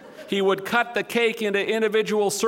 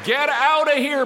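a loud, higher bellow; 6 semitones higher, 3 dB louder than usual. Acted. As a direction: bellow it to chase something off. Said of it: "bellowed Dave".

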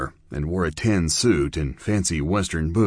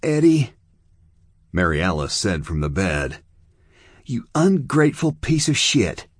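put-on higher, louder drunken fury; Mary Alice turning on Eddie, louder and higher; bitter accusation; 5 semitones higher, 3 dB louder than usual. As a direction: spit it at him. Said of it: "drunk woman confronts Eddie".

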